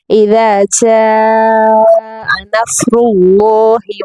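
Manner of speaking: slow melodic Quran recital; slow recitation with a rising end; tajweed recitation. Shown as a demonstration. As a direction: chant the verse slowly and evenly.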